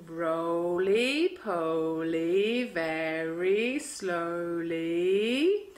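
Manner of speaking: low, drawn-out roly poly; very slowly. Shown as a demonstration. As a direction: draw every word out very slowly.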